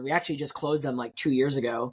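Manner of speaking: usual speaking voice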